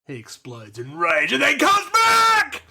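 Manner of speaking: building to a high, long scream; slowly build, then explode; hammed-up triumphant roar; explosive yell. Shouted climax of speech.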